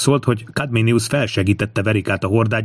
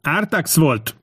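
narrated yell, pitched high; shout; 6 semitones higher, much slower than usual. A narrator's reading. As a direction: shout the blame onto another. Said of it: "üvöltötte Tinkomius".

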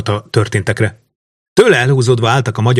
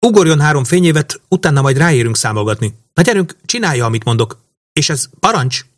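high, agitated princess's voice; insistent young commander, voice pitched up; impatient commanding; 6 semitones higher than usual. A narrator's reading. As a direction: press the pilot in a raised pitch. Princess keeps shouting orders at pilot.

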